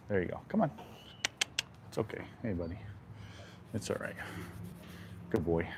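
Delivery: hushed, calming words to a skittish horse; soothing the horse. Real, not acted. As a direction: gently settle him down, barely above a breath.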